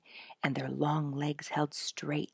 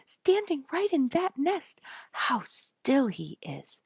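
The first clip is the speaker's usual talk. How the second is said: put-on excited child; 11 semitones higher, 4 dB louder, slower than usual. A narrator's reading. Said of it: "said the excited child".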